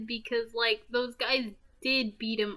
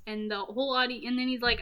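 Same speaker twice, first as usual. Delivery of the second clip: ordinary talk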